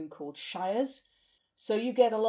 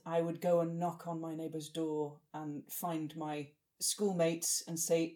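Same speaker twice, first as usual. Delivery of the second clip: normal talking voice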